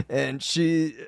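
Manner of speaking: normal talking voice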